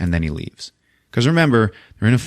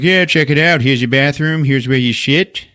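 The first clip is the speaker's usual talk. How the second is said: mock male nurse; 6 semitones higher, 4 dB louder than usual. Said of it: "there's a male nurse there named Carlos".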